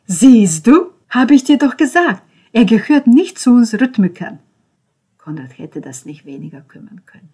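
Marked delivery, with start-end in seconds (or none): 0.1-4.4 high, loud, smug; gloating told-you-so. Acted. Ben gloating to Konrad, high and loud. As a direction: crow it over him.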